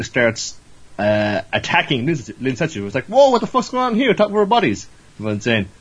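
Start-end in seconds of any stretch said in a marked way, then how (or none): 3.1-4.9 high, louder-edged mock alarm; startled protest. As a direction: protest a friend's sudden attack, pitched way up. Acted shock at sudden attack.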